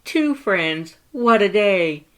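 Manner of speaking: very high, loud wonder; marveling at a new friend; delighted. Acted. Papyrus elated.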